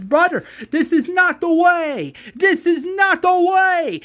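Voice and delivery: high, loud pleading yell; acted scream. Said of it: "that's when Rick screams".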